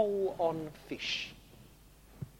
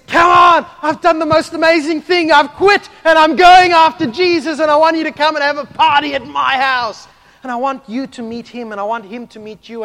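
much higher, very loud overjoyed shout, stammering at the end; excited shout; 10 semitones higher, 17 dB louder than usual. Retold or imitated. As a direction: burst out to your mates with joy. Matthew excitedly invites his friends.

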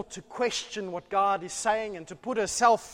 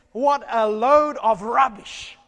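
loud, slowed, mocking dismissal; Satan's scorn; 2 semitones higher, 10 dB louder, slower than usual. Preacher voicing Satan mocking God's word, loud slow scoff. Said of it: "And Satan says".

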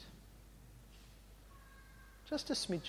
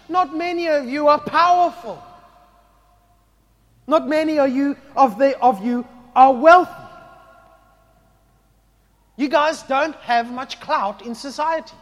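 loud, high, driving sermon voice; fervent preaching; 12 semitones higher, 18 dB louder than usual. Acted. Preacher rousing the congregation, loud and high. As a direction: preach it out to the whole hall.

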